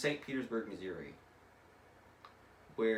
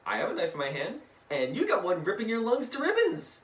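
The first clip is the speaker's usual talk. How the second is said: raised voice building to high and loud; mock-cheery taunt; 11 semitones higher, 7 dB louder than usual. Deadpool about to stab Ishmael, taunting louder and higher. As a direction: build the threat into a hammed-up yell.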